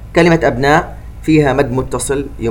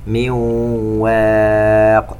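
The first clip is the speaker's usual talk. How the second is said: slow chanted tajweed recitation with echoing qāf; Quran recitation; much slower than usual. Shown as a demonstration. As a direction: recite slowly, bouncing the qāf. (تلاوة مرتلة ببطء مع قلقلة القاف)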